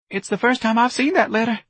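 very high, anxious piping; Mister Gonzalez piping. A narrator's reading. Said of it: "Mister Gonzalez piped".

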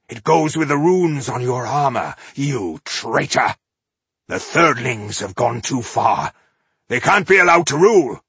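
loud, high angry accusation. Acted. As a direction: spit out the word traitor. Ironheart accusing a traitor.

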